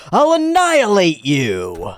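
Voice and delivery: raging threat; berserk rage. Acted. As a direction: spit the threat out.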